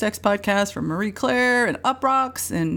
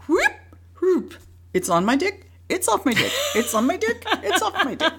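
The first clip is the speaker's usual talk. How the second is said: singsong, rising higher and laughing toward the end; playful sing-song; 6 semitones higher than usual. Retold or imitated. Naked guy showing off his ring, singsong laughing. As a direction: tease it out like a giddy party boast. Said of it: "he'd go".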